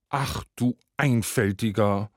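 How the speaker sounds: mild exasperated lament; jammernd wailing wife. A narrator's reading. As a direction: lament at a simple-minded husband. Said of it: "jammerte die Frau".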